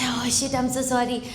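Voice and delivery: mock apology.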